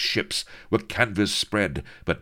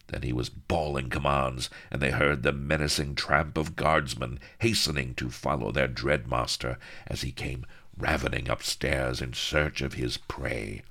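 usual speaking voice

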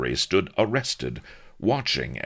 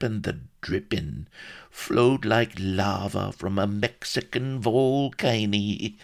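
high, savouring country voice; rustic old storyteller; 8 semitones higher than usual. A narrator's reading. Solomon remembers a grand spread, slow relishing rustic voice.

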